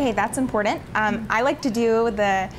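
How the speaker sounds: ordinary talk, nothing marked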